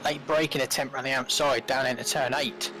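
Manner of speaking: ordinary talk, nothing marked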